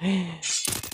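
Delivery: a quick frightened intake of breath; gasp. Real, not acted. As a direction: catch your breath in alarm. Sudden in-game danger.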